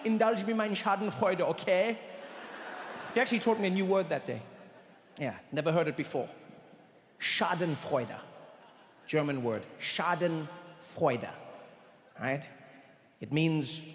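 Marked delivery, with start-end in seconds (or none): 0.1-2.0 put-on German-accented soothing; Rolf. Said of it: "He's like". 9.8-11.3 Schadenfreude said very slowly, split in two; slow, deliberate Schadenfreude; drawn out. Shown as a demonstration. Repeats the word for emphasis.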